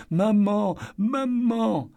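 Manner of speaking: put-on injured girl sobbing for her mum; sobbing little girl. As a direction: cry for her mother like a hurt child. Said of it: "sanglotait-elle par saccades".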